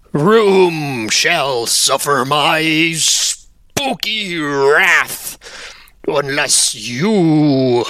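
slow, drawn-out ghostly voice; Spooky Wisp. Acted. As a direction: stretch every word like a pompous ghost. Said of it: "it calls out to you".

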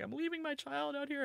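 high-pitched mock crying; imitated tearful dad. Father dropping off his child, imitated high tearful wail. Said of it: "and he's, like, crying".

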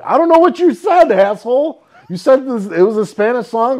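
loud high indignant comeback; mock angry yell at the friend about the song; loud retort. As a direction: shout back at him, annoyed. Retort to friend over music.

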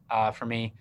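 ordinary talk

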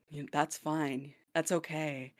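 very soft, slow acceptance; uneasy backing down. Retold or imitated. Lodger giving up on getting roommate's answer, quiet backing down. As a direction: let it shrink to a small, careful reply.

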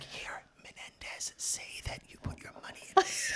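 voiceless whisper of a schemer feeding lines; conspiratorial whisper. Retold or imitated. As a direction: whisper the script as a secret tip. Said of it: "He's like".

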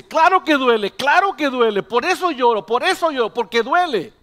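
put-on high, loud child's wail of protest; niño que protesta llorando. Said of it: "Y el niño dice". (niño quejándose a gritos, con voz aguda)

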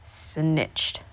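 low, soft grumble; muttered. Acted. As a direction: mutter it under your breath. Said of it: "muttered the old man".

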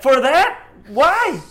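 high, loud for that why; incredulous shout. Retold or imitated. Man acting out what he wanted to shout at the mom, loud and high. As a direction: yell it in disbelief. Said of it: "I wanted to be like".

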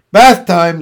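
loud, cheerful announcement. A narrator's reading. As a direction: call it out cheerfully, with volume. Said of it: "said Zuckerman cheerfully".

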